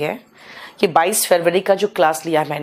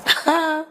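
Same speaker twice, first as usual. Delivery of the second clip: a quick real laugh, high and brief; short laugh; 7 semitones higher than usual. Real, not acted. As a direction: let a small laugh slip out. Teacher laughs at chat comment.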